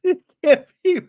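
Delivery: very high squeaky real laughing; helpless laughter. Real, not acted. Host finds the drama so stupid, real helpless laughter. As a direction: try to speak through a laughing fit.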